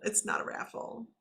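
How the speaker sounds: soft whispered repeat; whispered aside. Real, not acted. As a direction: drop to a breathy whisper for It's not a raffle. Quiet aside after loud version.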